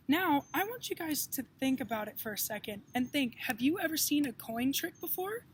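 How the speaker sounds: plain reading voice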